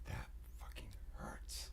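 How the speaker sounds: low, faint whisper. Real, not acted. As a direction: whisper it, shaken. After a gasp.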